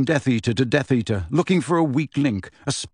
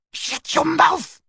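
shrill, much higher slow shriek; 14 semitones higher, slower than usual. A narrator's reading. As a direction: scream it at him, outraged. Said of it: "Bellatrix shrieked".

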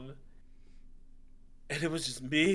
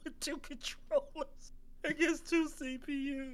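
real laughter lifting the voice high; laughing while talking; 8 semitones higher than usual. Real, not acted. Player admits he faced two computers alone, laughing while talking. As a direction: struggle to tell it through laughs. Laughs telling his misfortune.

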